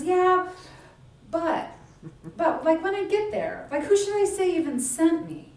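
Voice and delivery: a hesitant Moses pushing back; doubtful Moses. Acted. Moses doubting, voiced by preacher.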